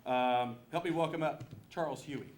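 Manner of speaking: announcer's introduction. Real, not acted. Emcee welcoming a candidate to the stage, loud and high. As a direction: project it to bring on the next speaker.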